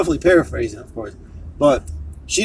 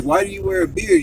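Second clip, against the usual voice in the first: quoting the woman. Retold or imitated. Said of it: "she's like".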